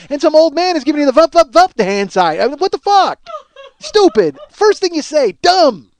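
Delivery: high, loud comic tirade; exasperated, full-volume rant; furious self-berating. Acted. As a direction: shout at yourself like an idiot being told off. Rants at himself as stupid.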